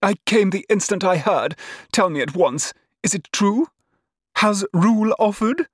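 high, excited questioning; eager exclaiming. A narrator's reading. Cousin rushes in after news, exclaiming eagerly. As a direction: gush the questions high in pitch. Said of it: "she exclaimed".

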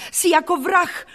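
high, indignant outburst; outraged, defiant girl; crying out accusations. A narrator's reading. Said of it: "vykřikla jsem".

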